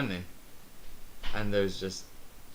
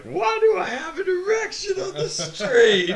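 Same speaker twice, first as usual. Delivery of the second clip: mock sobbing; 7 dB louder than usual. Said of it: "I just started sobbing".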